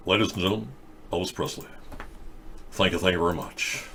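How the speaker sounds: a hint of [name] thanking the crowd, low-key; [name] on stage. Acting [name] stage thank-you.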